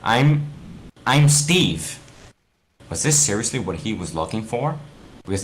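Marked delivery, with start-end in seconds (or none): 0.0-2.0 stammering, raised voice; nervous Steve. Acted. Steve introducing himself to Alex, nervous. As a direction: answer hesitantly, caught off guard. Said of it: "Steve answers Alex".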